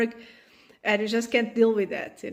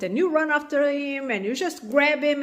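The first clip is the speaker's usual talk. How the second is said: normal talking voice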